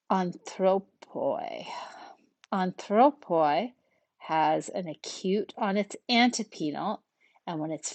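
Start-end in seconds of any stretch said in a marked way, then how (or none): 0.1-3.7 slow, drawn-out "anthropoi."; careful word demonstration. Shown as a demonstration. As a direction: sound out "anthropoi" slowly for learners. Teacher models Greek example word.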